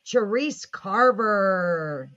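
drawn-out announcer intro. Acted. Host introducing the guest to the audience, slow announcer drawl. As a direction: stretch the name out slowly, like a stage announcer.

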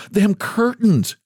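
high-pitched, slow and urgent; a hint of a gasp, pitched high. A narrator's reading. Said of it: "gasped she".

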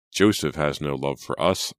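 ordinary delivery

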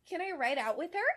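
put-on excited little girl. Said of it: "she asked excitedly".

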